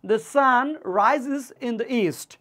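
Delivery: The sun rises in the east read very slowly; careful classroom model sentence. Shown as a demonstration. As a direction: read it slowly for students to repeat. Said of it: "teacher models the sentence".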